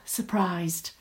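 usual speaking voice

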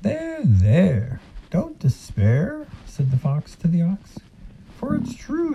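high, coaxing fox; sly silky fox. Acted. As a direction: reassure him with false sweetness. Fox comforts ox.

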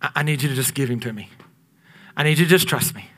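a hint of God's gentle correction. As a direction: speak as a patient God. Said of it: "And God's like".

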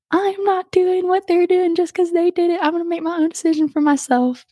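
mock-indignant youngest sibling, high-pitched; high, sustained defiant declaration, played up; mad. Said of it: "that made me mad".

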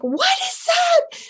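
high, startled squeak; surprised. Retold or imitated. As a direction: gasp it out in sudden wonder. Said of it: "I'm like".

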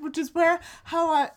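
ordinary delivery

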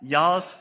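"Y'all's" thrown out high and loud; loud, high, playful "Y'all's"; amused stress on Y'all's. Acted. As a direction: hit "Y'all's" hard, relishing the word. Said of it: "he does say y'all".

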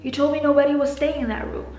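angry accusation. Acted. Guest confronting the receptionist about the empty room, angry and loud. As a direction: call out the lie forcefully.

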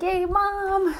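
high happy cheer; mock cheer. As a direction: squeal it for mom. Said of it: "I was like".

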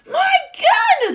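high, loud furious yell; shouting. A narrator's reading. Duke shouting to gardener for his gun, furious shout. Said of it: "the Duke was shouting".

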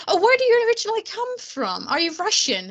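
high-pitched, mock-amazed questioning; put-on high voice of curiosity; curious stranger. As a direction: imitate a nosy stranger, pitch jumping up. Retold strangers puzzled by the accent.

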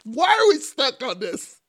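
loud, high-pitched protest; high, loud complaint; exasperated outburst. Real, not acted. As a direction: cry out in exasperation at a silly argument. Exasperated at the silly argument.

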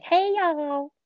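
high, drawn-out Southern greeting; Southern accent. Shown as a demonstration. Demonstrating American accents to cohost, exaggerated Southern accent. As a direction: put on a mock Southern accent. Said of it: "your Southern accent".

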